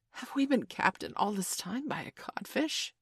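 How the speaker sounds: plain reading voice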